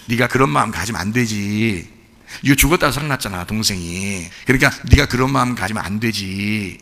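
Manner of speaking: low, soft fatherly rebuke; gentle father. Retold or imitated. Father gently rebukes jealous elder son, low and soft. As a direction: coax the son like a patient father.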